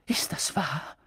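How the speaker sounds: whispered, unsteady question; scared to hear the answer; frightened whisper; stammered whisper. A narrator's reading. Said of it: "stammelte Professor McGonagall".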